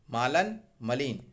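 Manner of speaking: normal talking voice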